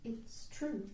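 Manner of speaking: soft, level admission; hushed, controlled dignity; quiet, steady. A narrator's reading. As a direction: say it softly with composure. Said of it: "in a quiet, steady voice".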